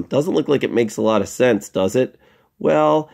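ordinary talk, nothing marked

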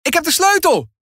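high call across the room. A narrator's reading. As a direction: call the boys over, excited. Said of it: "roept Tess dan". (Tess roept de jongens hoog en opgewonden)

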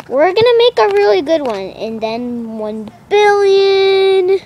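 high, loud hype building to a stretched number; excited hype. Real, not acted. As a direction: shout it like a huge milestone. Hyping an even bigger milestone.

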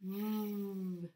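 a long buzzed vvv with lips on teeth; buzzing V sound. Shown as a demonstration. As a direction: vvv buzz.

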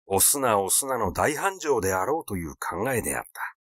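ordinary delivery, nothing marked

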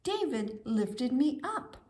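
ordinary delivery, nothing marked

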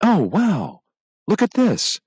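put-on breathless wow of a child finding something; excited exclaiming. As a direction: point it out with delight. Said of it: "Devin exclaimed suddenly".

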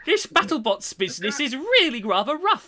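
put-on light, hammy British accent; mock British accent. Fan pretending to be British competitor, light British accent. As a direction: play a prim British gent, lightly. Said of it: "stereotypical British accent".

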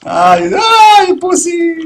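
high mocking yell; mocking shout at the hiding opponent; taunting shout. Real, not acted. Taunting opponent after a kill.

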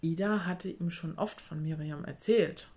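ordinary delivery

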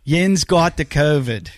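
put-on drawn-out Pittsburgh yinzer accent; slow, broad Pittsburgh drawl on yin's. Laying on a Pittsburgh accent for the buddy on the call. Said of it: "says more about the Pittsburgh accent".

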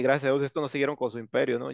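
usual speaking voice

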